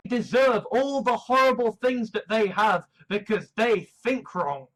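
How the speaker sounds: ordinary talk, nothing marked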